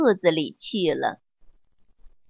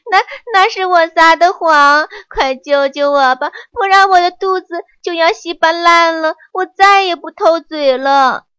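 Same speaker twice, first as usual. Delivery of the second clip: higher, louder desperate begging; panicked pleading; 7 semitones higher, 7 dB louder than usual. A narrator's reading. Monkey begs the master to save him, panicked and loud. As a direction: stammer and plead in a rush.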